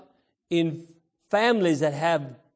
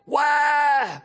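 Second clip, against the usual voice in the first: put-on high, loud shouted baby wail; mock desperate baby cry; 8 semitones higher, 5 dB louder than usual. Preacher to children, imitating a desperate newborn cry. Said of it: "sounds like a desperate cry".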